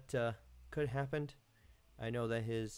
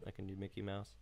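normal talking voice

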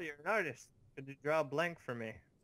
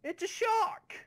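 very high, loud mangled shriek; garbled speech imitation; 6 dB louder, slower than usual. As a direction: squeal it like you can't talk right. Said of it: "a person that can't speak properly".

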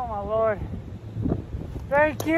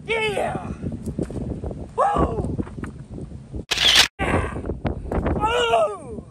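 excited whoops; 10 semitones higher, much slower than usual. Real, not acted. Whooping over a big catch.

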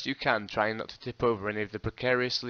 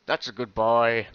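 menacing gangster; 7 dB louder, slower than usual. Acted. Crime lord's man voiced.

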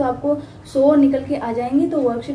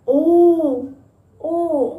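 higher, louder oh of wonder; amazed oh; 3 semitones higher, 3 dB louder than usual. Shown as a demonstration. Teacher leading class to gasp at a child. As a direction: gasp oh at something interesting. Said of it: "दूसरे बच्चों को भी साथ में लेके उसकी तरफ करते हैं".

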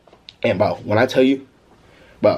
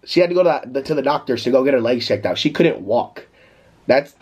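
ordinary talk

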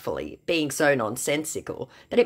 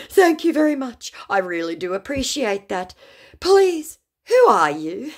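much higher, louder whiny sorry voice; mock pleading apology; 11 semitones higher, 5 dB louder than usual. Scammer grovels to narrator for forgiveness, high whiny voice.

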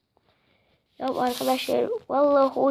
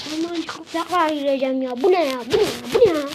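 ordinary talk

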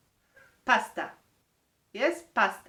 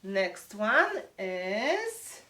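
"Next one is" drawn out slowly, rising; suspenseful slow; much slower than usual. Shown as a demonstration. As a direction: stretch it out to tease the class. Teacher builds suspense before word.

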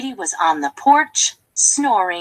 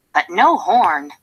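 ordinary delivery

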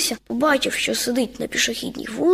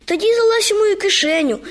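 plain reading voice

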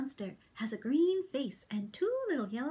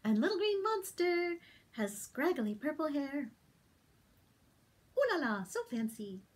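plain reading voice

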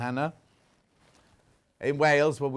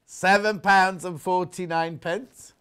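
slow, loud, higher price call with a rising end; price announcement; 4 semitones higher, 5 dB louder, slower than usual. Real, not acted. Seller repeating the price to viewers, drawn-out rising announcement. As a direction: sing out the price slowly, lifting at the end.

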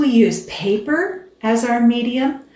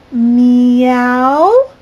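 mock meow; 3 semitones higher, 10 dB louder than usual. Narrator models meow.

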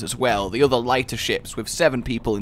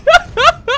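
high laugh; 9 dB louder than usual. Real, not acted. Laughing at own mistake.

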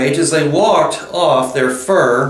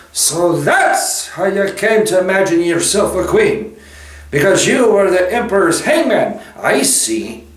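high character voice, mocking; scornful Beaver; 7 semitones higher than usual. A narrator's reading. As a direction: jeer at the witch as the indignant Beaver. Mister Beaver retorts to witch.

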